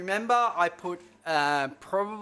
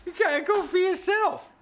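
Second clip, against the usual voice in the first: much higher, mocking voice of a doubter; 13 semitones higher, 4 dB louder than usual. To viewers, mimicking a doubting viewer in a high mock voice. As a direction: sneer it like a viewer who thinks you can't cook. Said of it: "you might probably think".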